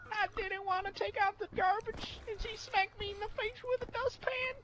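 put-on high, soft whiny child voice; hammed-up pitiful child telling a sob story; mock sob story. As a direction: whimper it like a pitiful kid.

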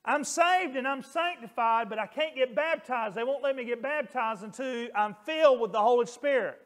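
put-on high, loud, eager child; lightly voiced child, bright and eager; child's voice. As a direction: lift the voice into an excited kid. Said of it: "It said".

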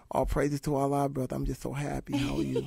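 soft lightly voiced Mike Tyson; a hint of a calm Mike Tyson; quiet peaceful Tyson impression; imitating Mike Tyson. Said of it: "I called Mike about a year ago".

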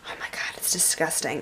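hushed, grossed-out whisper; whispered oh my God, it's disgusting; disgusted whisper. Real, not acted. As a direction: breathe it out in disgust. Grossed out by dust behind door.

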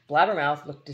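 ordinary delivery, nothing marked

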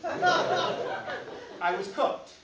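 laughing. Real, not acted. Comic punchline, drawn out laughing.

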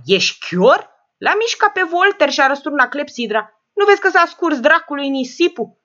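put-on loud, sharp old woman's scolding. Old lady scolds George over the hourglass, loud and cross.

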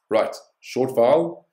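"short vowel" said high, loud and slow; emphatic rule. Shown as a demonstration. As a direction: stress the rule slowly. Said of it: "if it ends in a short vowel".

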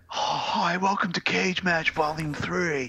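drawn-out put-on host voice, sighing between phrases; slow, mannered star hosting a fight; Nicolas Cage. Host welcoming viewers to a cage match, put-on star voice. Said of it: "Nicolas Cage is at his house?".

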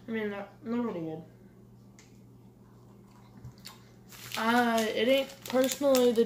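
lips on bottle rim; speaking between sips; drinking from a bottle. Real, not acted. Seen 1: No chewing seen at 0–1.8; bottle at lips from 2.1.

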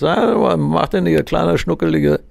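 a hint of a drunk on the phone; slurring drunk caller. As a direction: play a tipsy caller, words a bit slurred. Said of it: "hat eine leicht lallende Stimme".

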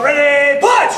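loud karate punch call; coach shouting commands. Real, not acted. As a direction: bark out the command.

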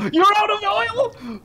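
high, slow shocked question; a gasp then the worry; alarmed gasp at the news; gasping surprise. Real, not acted.

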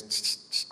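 soft unvoiced tss, tss, tss to get attention; imitated hiss. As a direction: hiss it quietly like a teammate from mid-off. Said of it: "next minute I hear this".

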